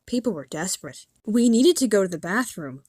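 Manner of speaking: ordinary delivery, nothing marked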